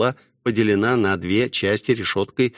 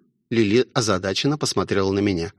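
ordinary delivery, nothing marked

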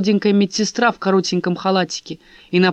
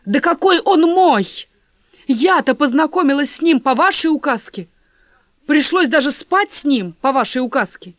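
indignant; 7 semitones higher, 4 dB louder than usual. Acted. Лиза возмущена.

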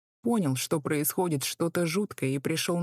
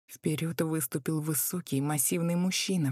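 plain reading voice

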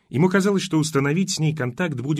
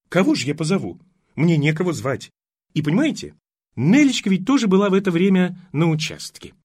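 higher, louder doting mother; fussy old mother; 6 semitones higher, 5 dB louder than usual. Acted. Mother scolds her daughter for worrying the young man, higher and louder. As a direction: fuss and protest over him.

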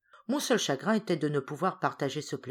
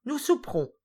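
much higher, relishing the supper; gleeful promise; 10 semitones higher, 3 dB louder than usual. A narrator's reading. Hippel patting his belly about supper, gleeful and high. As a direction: savour the words like a glutton. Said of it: "en se caressant le ventre".

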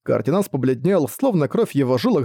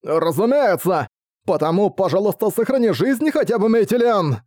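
feigned sincerity; 6 semitones higher than usual. Acted. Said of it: "очевидно солгав".

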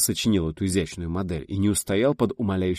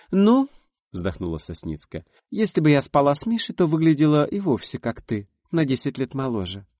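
higher, louder playful voice; teasing friend; 7 semitones higher, 4 dB louder than usual. A narrator's reading. Friend teasingly sighing about the healer. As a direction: tease your friend with a sly sigh.

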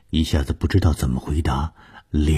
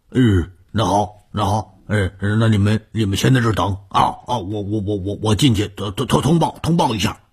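put-on stammering old man, higher-pitched and hurried; flustered old servant; 6 semitones higher, 3 dB louder than usual. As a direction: trip over repeated words like a nervous old retainer. (慌张结巴的老头)

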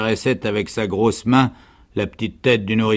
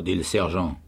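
usual speaking voice

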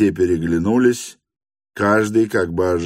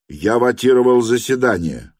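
higher, moderately raised voice; schoolboy's cry; 5 semitones higher, 5 dB louder than usual. A narrator's reading. Gymnasium student addresses the hostess, higher protesting cry. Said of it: "крикнул гимназист".

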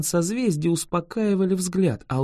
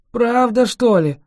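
loud, higher startled question; surprised; 6 semitones higher, 6 dB louder than usual. A narrator's reading. Dragon hears about the petrifying spell, surprised and loud. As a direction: sound truly taken aback. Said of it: "удивился дракон".

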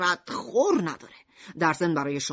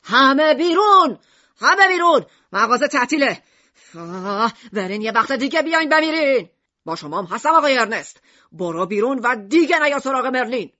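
loud, raised shopkeeper's yell; angry shout; 5 semitones higher, 8 dB louder than usual. A narrator's reading. Shopkeeper throwing every customer out, shouting. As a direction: shout them all out at full volume. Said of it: "میشیما فریاد کشید".

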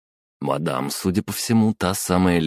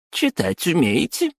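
squeaky sarcastic woman; 12 semitones higher than usual. Acted. Clerk mocks him.

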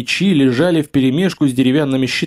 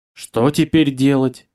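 usual speaking voice

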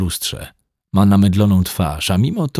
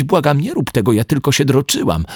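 higher, frightened begging; pleading; 6 semitones higher than usual. Acted. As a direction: beg him desperately not to. Begging him not to open. (błagalnie, ze strachem)

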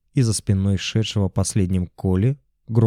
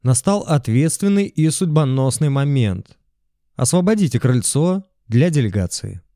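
rally speech; 5 semitones higher than usual. A narrator's reading. Megaphone speech to crowd.

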